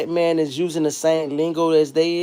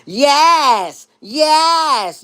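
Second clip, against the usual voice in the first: higher, excited yas; 9 semitones higher than usual. Retold or imitated.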